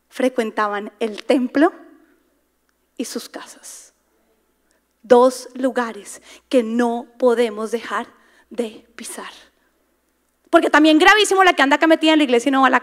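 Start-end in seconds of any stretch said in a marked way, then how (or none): 3.0-3.9 breathy dramatic whisper on the last words. Acted. Preacher drops voice for emphasis. 8.5-9.3 whisper. Acted. Preacher drops voice for emphasis.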